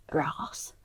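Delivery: hushed, breathy whisper of a cheeky word; whispered aside. Real, not acted. Whispering a cheeky word to her co-star. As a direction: drop to a whisper for the rude bit.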